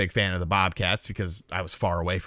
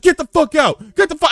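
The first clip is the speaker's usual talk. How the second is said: high, loud mimicked yell; retold yelling; 6 dB louder than usual. Woman throwing her guest out at night, yelling. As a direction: imitate someone yelling to throw you out. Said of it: "just starts yelling at me".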